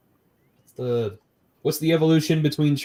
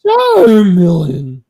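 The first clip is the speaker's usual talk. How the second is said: Pokemon cry; 5 semitones higher, 8 dB louder, much slower than usual. Pretend. Slow, loud Charmeleon cry.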